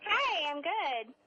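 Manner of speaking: high, slow, cheerful greeting; sunny, upbeat hello; bright and bubbly. Real, not acted. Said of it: "You sound very bright and very bubbly".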